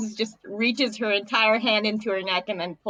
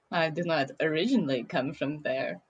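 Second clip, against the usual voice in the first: put-on lower, formal Russian-accented correction; 4 semitones lower, 4 dB quieter than usual. Merfolk clarifying their origins to the duelist, lower Russian accent. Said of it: "You faking that accent?".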